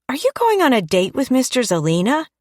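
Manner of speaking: put-on high, curious child voice; little girl voice. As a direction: voice a nosy young girl. Young daughter Mary asking.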